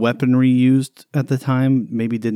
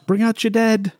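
high mock call; 11 semitones higher than usual. Echoes the guards' call.

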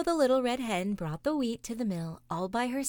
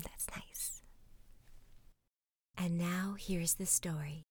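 ASMR whisper; 5 dB quieter than usual. Acted. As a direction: whisper gently as if guiding a meditation. Guided five senses exercise.

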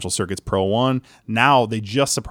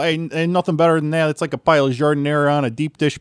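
loud, higher-pitched Chicago gangster impression; thick Chicago accent; 6 semitones higher, 5 dB louder than usual. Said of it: "thickest Chicago accent you've ever heard".

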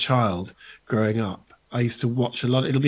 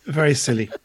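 ordinary talk, nothing marked

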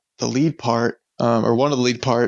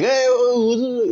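casual high greeting; 11 semitones higher, much slower than usual. Retold or imitated. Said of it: "just like".

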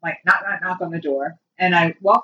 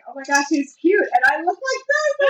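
high-pitched flustered thought, rising higher; flustered inner panic; 8 semitones higher than usual. Retold or imitated. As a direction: squeal inwardly at the cute guy. Inner panic at cute man.

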